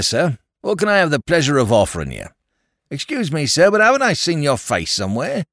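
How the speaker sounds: homely, cheerful Cockney barman; Cockney landlord. A narrator's reading. Landlord reminiscing to the lord, cheerful Cockney chat. Landlord greets fellow Londoner.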